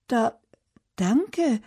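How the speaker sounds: hesitant, mildly baffled thanks; confused. A narrator's reading. Mira thanks Bernd for the found pet, confused. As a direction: break it with a pause, slightly puzzled. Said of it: "sagt sie verwirrt".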